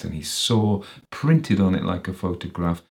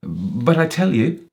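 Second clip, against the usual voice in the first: high, urgent protest; raised, pleading voice; crying out; 7 semitones higher, 4 dB louder than usual. A narrator's reading. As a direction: cry out in desperate denial. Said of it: "cried Walter".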